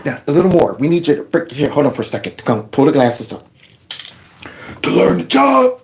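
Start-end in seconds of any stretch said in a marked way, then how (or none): 4.8-5.8 high-pitched fake sob imitation; hammed-up weepy impression; mock crying. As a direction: squeak it out as if faking tears. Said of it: "he's not crying enough".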